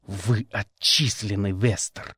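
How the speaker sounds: icy final verdict; a stern rector expelling the student coldly. A narrator's reading. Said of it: "холодно сказал ректор".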